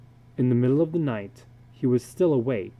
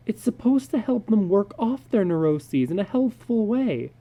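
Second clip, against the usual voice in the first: higher, worried wife; a lightly voiced anxious wife asking; wife questioning her husband in the dark; wife's voice; 8 semitones higher than usual.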